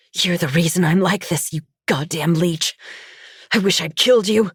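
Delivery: venomous scolding, rising in pitch; angry mother. A narrator's reading. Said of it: "she shouted".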